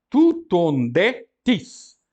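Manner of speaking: "tu ton de tis" very slow and loud, word by word; slow loud Greek reading. Shown as a demonstration. Teacher reads a phrase aloud to the class, slow and loud. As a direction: drag out "tu ton de tis" deliberately. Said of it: "Então você lê tudo junto".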